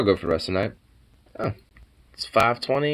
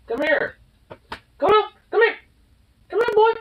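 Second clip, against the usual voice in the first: much higher, coaxing turning exasperated; high-pitched calling the pet, exasperated. Real, not acted. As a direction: lure the pet up high, then lose patience.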